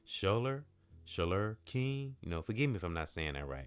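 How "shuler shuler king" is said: "Shuler King" drawn out slowly, "SHOH-ler", testing the name; careful pronunciation. Shown as a demonstration. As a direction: say "Shuler King" slowly, trying to get it right. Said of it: "I feel like I can't say that right".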